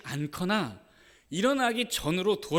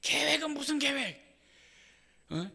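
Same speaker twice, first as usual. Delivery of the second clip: much higher and louder, building to a scream; retold shout; 11 semitones higher, 6 dB louder than usual. Mother on the floor screams her question at the pastor. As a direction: scream the accusation at God. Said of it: "하고 막 소리칩니다".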